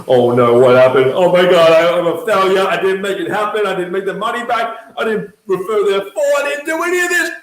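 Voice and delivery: loud, rising panic; panicked self-blame, loud and climbing high; panicking. Retold or imitated. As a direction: spiral into dread. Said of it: "And I'm just like".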